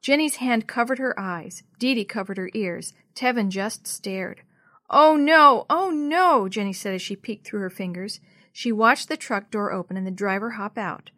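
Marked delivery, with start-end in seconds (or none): 4.9-6.5 loud, high, horrified exclamations; dismayed cry. A narrator's reading. Jenny peeking at the stopped truck, crying out in dismay. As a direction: cry out in dread. Jenny fears pets were hit.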